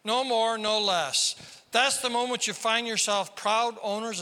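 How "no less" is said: ordinary talk, nothing marked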